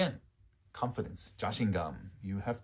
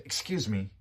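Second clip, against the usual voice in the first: irritated, confrontational tone; rude, frustrated. Shown as a demonstration. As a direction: say it as if fed up with the crowd. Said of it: "They get rude, they get angry, they get frustrated".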